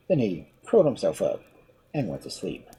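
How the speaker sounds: ordinary delivery, nothing marked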